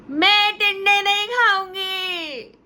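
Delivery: put-on high, slow, whiny little girl; child refusing. Daughter refusing to eat tinda, slow whiny wail. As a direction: drag out the refusal like a sulking child.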